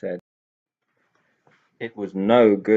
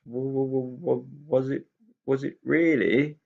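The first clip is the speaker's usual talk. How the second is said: higher, halting stutter that finally gets the words out; a nervous pixie stuttering over every start; frightened stammer in a changed voice; stammering too; 6 semitones higher than usual. A narrator's reading. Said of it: "stammering too".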